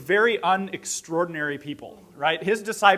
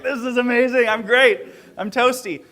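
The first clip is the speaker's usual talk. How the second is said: lightly upbeat, a little higher and louder; mock-cheerful reply; 5 semitones higher, 5 dB louder than usual. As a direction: chirp back that all is wonderful. Cheerful reply to guide in storm.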